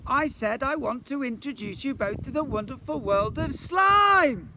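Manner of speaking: high, loud announcement rising to a yell. A narrator's reading. Boy summoning slime, high full-voiced shout. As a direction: proclaim it at full volume, last word stretched. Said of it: "Shout louder".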